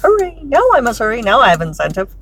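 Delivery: high, airy mock-dithering voice; mock oblivious driver. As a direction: play the dithering driver, sing-song. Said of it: "they're like".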